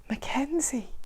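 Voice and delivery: soft hushed whisper; calm him with a whisper. Acted. The woman whispering Mac's name to calm him. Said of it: "she whispered".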